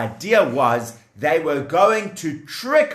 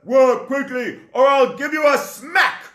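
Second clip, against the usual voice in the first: threatening taskmaster; 9 semitones higher than usual. Acted.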